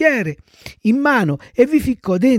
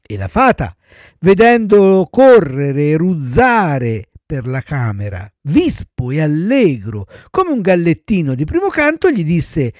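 ordinary delivery

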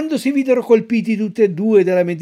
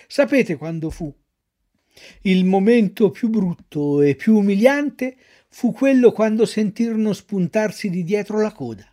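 ordinary delivery

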